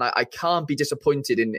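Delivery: ordinary talk, nothing marked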